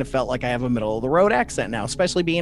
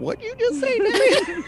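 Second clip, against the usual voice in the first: very high-pitched incredulous question; mock bafflement. Reacting to friend's mom's word, mock baffled. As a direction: shoot the pitch up in mock shock. Acted startled reply to odd word.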